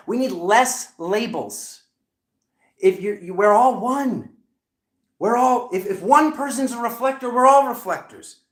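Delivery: high, loud, emphatic plea; riled rant. Real, not acted. Reader arguing against spiritual labels, loud riled rant. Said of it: "Sometimes I get riled".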